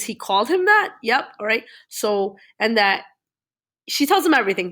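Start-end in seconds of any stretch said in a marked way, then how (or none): 0.0-0.9 retold astonishment. Uncle hearing what Francis called Atticus, retold with astonishment. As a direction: pitch leaps up in mock disbelief.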